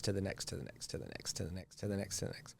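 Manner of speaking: repeated phrase dying to a murmur; fading repetition. Acted. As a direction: let each to the next fade away. Repeating urges, fading away.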